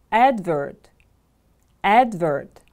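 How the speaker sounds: "Advert" with firm weight on AD; stress on first syllable. Shown as a demonstration. Teacher has class repeat after her, "Advert" stressed on AD. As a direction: lean on AD in "Advert", then fall away. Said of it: "The stress is on the first syllable".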